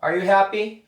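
high, loud practice question; asking partner. Shown as a demonstration. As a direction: ask "Are you hungry?" brightly up and loud. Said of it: "한번 연습해보죠".

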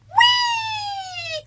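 very high, loud squeal of fun; gleeful whee. Acted. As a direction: squeal like a child on a rocking chair. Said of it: "cried Goldilocks".

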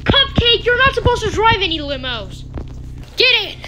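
high, loud surprised calls; half scold, half thrilled; excited scolding. Real, not acted. Dog driving a limo.

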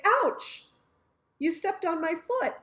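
mock yelp when a toe gets stepped on; pained ouch. Friend steps on your foot.

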